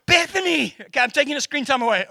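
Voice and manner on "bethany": high, drawn-out warning call of her name; dad calling his daughter's name, high and warning. Retold or imitated.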